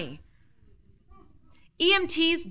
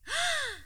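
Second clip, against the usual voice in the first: shocked gasp; 4 dB quieter than usual. Acted. Friend shocked by the news.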